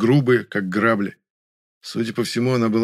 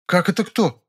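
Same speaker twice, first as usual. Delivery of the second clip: high-pitched, astonished woman; surprised; 9 semitones higher than usual. A narrator's reading. Woman is asked who she is, surprised. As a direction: ask in high-voiced disbelief. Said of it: "удивилась женщина".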